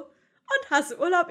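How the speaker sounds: loud, slow, cheerful mock chimney sweep; quoting the chimney sweep. Teen retells the chimney sweep's question to her friend, loud and slow. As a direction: boom out his small-talk question with a grin. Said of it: "weil der war so".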